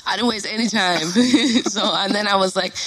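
ordinary talk

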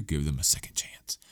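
unvoiced hushed whisper; whispered advice. Mock advice to listeners about exes, whispered. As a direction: breathe it like a secret tip.

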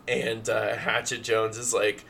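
ordinary talk, nothing marked

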